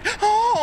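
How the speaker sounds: high, loud startled gasp; sharp gasp. Real, not acted. Player gasps as the creep appears, startled. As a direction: suck in air in fright. Sudden scare in game.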